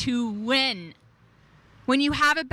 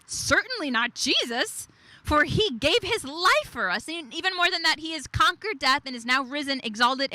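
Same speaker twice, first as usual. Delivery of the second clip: ordinary talk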